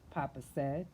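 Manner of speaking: plain reading voice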